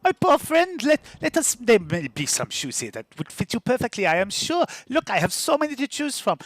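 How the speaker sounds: a showy market trader sizing up a customer; shoe-selling woman. Acted. GM voices a shoe seller.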